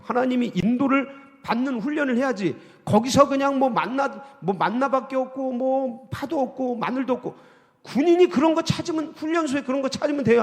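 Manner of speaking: high, loud, driving sermon build; climbing preacher's call; fiery preaching. Acted. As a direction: preach it out, rising and insistent. Preaching at full force.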